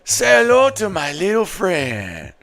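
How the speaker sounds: high, slow put-on Colombian accent. Streamer repeating the movie line for chat, Colombian accent. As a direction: stretch the words out in a mock Colombian accent. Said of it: "Say in a Colombian accent".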